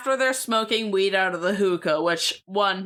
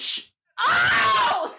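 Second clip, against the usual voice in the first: sudden high shocked cry; shocked yelp. Real, not acted. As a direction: gasp it out in disbelief. Shocked by Swayze news.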